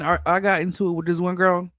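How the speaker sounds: ordinary talk, nothing marked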